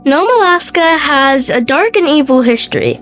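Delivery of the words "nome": ordinary talk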